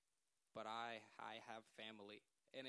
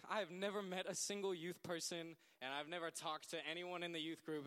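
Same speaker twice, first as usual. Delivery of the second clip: indignant; 5 semitones higher, 7 dB louder than usual. Retold or imitated. Protesting outrage, retold.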